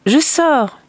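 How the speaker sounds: high, loud announcement; eager father. Acted. Father announces he is going out, eager. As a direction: burst out with eager resolve.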